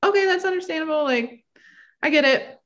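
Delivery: high, fast, breezy politeness; overly understanding. Retold or imitated. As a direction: brush it off cheerfully. Retelling her own forced-polite reply.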